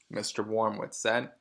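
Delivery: usual speaking voice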